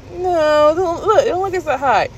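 airy brush-off; dismissive. Retold or imitated. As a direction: wave off the worry in a high voice. Acts out dismissing partner's warning.

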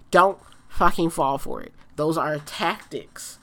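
ordinary talk, nothing marked

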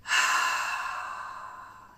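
voiceless breathy ah on the out-breath; audible exhale. Shown as a demonstration. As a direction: breathe out audibly to show the release. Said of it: "e poi".

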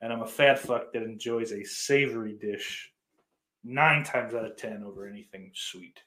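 ordinary talk

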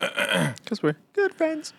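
high, drawn-out mock echo; sing-song it way up high, stretched out slow. Teasing a friend about being good friends, mocking echo.

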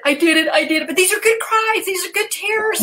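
high, wavering mock tearful voice, rising as it goes; retold happy crying. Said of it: "and she's crying again".

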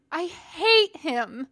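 high, loud, slow anguished cry; bawling. A narrator's reading. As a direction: sob it out, breaking. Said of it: "Anakin was bawling".

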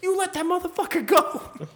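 high, loud mock yell; retold outraged bystander, voice way up; imitated angry demand. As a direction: shout it at the cops, hammed-up. Acts out bystanders confronting the cops.

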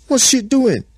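high, loud outburst; alarmed Tigress. A narrator's reading. Tigress watching Eve pluck the fruit, loud high alarm. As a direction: cry out in high-pitched disbelief.

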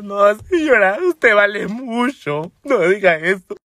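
high, drawn-out weepy imitation; hammed-up tearful consoling; mock crying. Consoling the crying neighbour while crying along, mock tearful. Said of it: "me puse a llorar con ella".

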